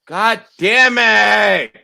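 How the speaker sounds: high, drawn-out frustrated shout; real yell. Real, not acted. Host cursing over his fantasy lineup, real loud yell. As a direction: yell it out loud, stretching the words. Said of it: "Sir, please don't yell in".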